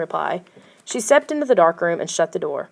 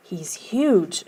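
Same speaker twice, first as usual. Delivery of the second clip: amazed; 3 dB quieter than usual. A narrator's reading. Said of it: "she cried in amazement".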